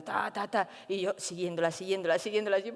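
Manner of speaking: ordinary talk, nothing marked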